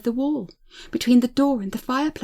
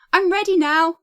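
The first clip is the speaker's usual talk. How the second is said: bright call, higher and louder; cheery; 6 semitones higher, 6 dB louder than usual. Acted. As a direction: call cheerily through the door. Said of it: "speaking cheerily".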